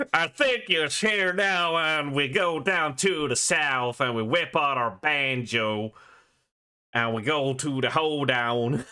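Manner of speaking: hammed-up Southern accent, drawn out at first; Southern twang. Joking about banjos and hoedowns, Southern twang. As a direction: put on a playful Southern US twang. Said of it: "It has a nice twang to it".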